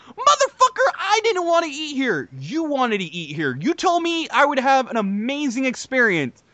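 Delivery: high outburst easing into pointed complaint; retold indignant argument; exasperated retort. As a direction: re-enact telling off a friend, opening high. Retold argument over tipping the woman.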